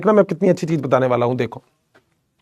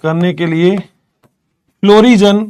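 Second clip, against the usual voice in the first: slow, word-by-word dictation with long pauses; teacher spelling out a note at writing pace; slower than usual. Acted. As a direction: dictate each word so students can write it down. Dictates notes to students.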